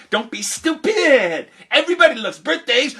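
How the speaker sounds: put-on high, loud childish scoffing; scornful retort. Friend on the bus dismissing the birthday warning, scornful retort. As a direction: dismiss it loudly, dragging out the insult.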